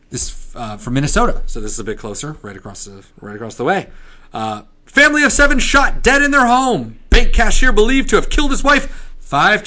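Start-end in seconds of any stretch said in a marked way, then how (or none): 4.8-9.6 high, loud newsboy hawking headlines; old-timey paperboy crying the news; newspaper kid. Pretend. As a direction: shout the headline like a street newsie. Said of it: "the newspaper voice".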